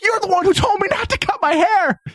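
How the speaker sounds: very high-pitched retold shout; hammed-up outraged yell; indignant mock yell. Groom yelling at his mom mid-ceremony, mock indignation.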